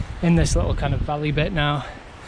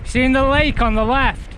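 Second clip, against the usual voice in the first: shouting over wind; 3 dB louder than usual. Real, not acted. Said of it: "I'll speak like this 'cause you won't be able to hear otherwise".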